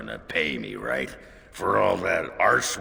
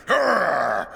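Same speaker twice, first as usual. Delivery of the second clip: mock growl; 10 semitones higher, 7 dB louder than usual. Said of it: "I was".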